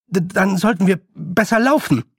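high, halting urging to flee; urgent stammer. Acted. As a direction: stammer it out in alarm. Boy urges flight from queen. (gehetztes, ängstliches Stottern)

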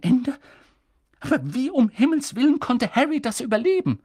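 high, loud and incredulous; aghast McGonagall. A narrator's reading. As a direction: cannot believe it.

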